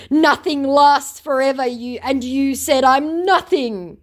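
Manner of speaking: high, loud, overblown protest; mock scammer comeback. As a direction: ham up an affronted scammer. Voices scammer's muddled comeback.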